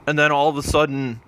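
normal talking voice

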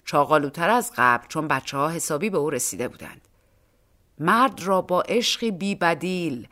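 ordinary delivery, nothing marked

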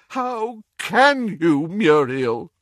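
slow, high, anguished groan. A narrator's reading. Doge appalled at Muriel, groaning. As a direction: drag the words out in dismay. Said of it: "groaned Doge".

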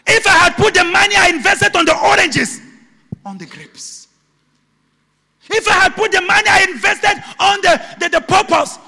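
loud, high, frantic wail of regret; anguished shout. Acted. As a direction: cry it out as a man who lost everything. Man wailing over lost chance.

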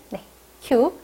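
ordinary talk

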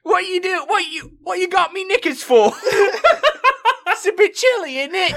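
very high falsetto British accent, laughing; British accent Garnet. Shown as a demonstration. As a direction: camp squeaky British accent, hammed up. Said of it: "my best British accent for Garnet".

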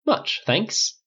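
cheerful thanks in a lifted voice. A narrator's reading. Flynn answers Riley's concern, cheerfully upbeat. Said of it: "Flynn said cheerfully".

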